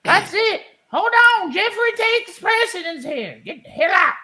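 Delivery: high sassy auntie coming back. Acted. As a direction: pitch up, then soften to the caller. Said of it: "auntie returns to the phone".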